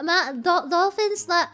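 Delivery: ordinary delivery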